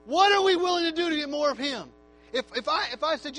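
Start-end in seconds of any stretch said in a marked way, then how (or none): 0.1-1.9 louder insistent repeat. Acted. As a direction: hammer the question again, louder and higher.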